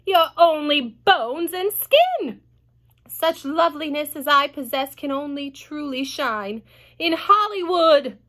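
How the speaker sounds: lightly voiced vain aunt; Aunt Sponge. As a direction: show off grandly. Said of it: "Aunt Sponge cried out".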